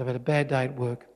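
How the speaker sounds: low, soft and subdued; lightly acted contrite dad; apologetic father. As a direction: offer a quiet peace gesture to your child. Father apologising to crying son.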